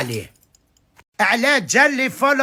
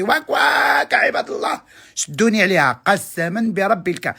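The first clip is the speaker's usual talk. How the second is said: mock outcry. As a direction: cry out to the crowd as if held back. Calling out, hold me back. (صرخة تمثيلية عالية)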